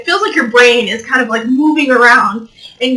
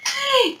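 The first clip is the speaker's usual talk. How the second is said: short high giggle; embarrassed laugh; 12 semitones higher, 8 dB quieter than usual. Real, not acted. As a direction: giggle at yourself. Laughs at mic mishap.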